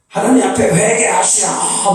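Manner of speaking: high, imitated preacher's cry; revivalist crying out. Revivalist calls the church to repent, crying out high. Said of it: "그러고 외치는 거예요".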